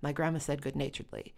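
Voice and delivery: ordinary delivery, nothing marked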